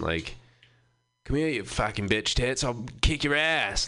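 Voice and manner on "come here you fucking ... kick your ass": put-on belligerent Maine drunk yelling; rough Maine accent, shouted higher. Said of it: "like, a Maine accent".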